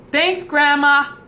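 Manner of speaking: loud, high, slow thanks Grandma; mock gratitude to grandma; drawn-out sarcastic thanks. Real, not acted.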